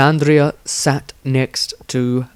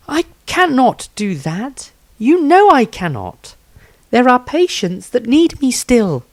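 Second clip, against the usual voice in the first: put-on higher girl's voice, firm and insistent; determined young healer; 14 semitones higher, 4 dB louder than usual. Healer refuses to leave her patients, higher and stubborn. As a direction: refuse him, holding your ground.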